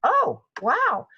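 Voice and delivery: delighted astonishment; student amazed by shot. Retold or imitated. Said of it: "another one would go".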